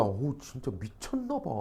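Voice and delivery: slow, very soft acted aside; hushed, drawn-out mock disbelief; disapproving aside. Onlooker commenting aloud on the kiss, hushed disapproval. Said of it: "어떤 사람 막 말로 합니다".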